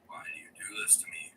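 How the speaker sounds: breathy whisper, no voice at all; whispered test line. Shown as a demonstration. Whispering a mic test so his sister can sleep. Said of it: "I can talk quiet and we'll be fine".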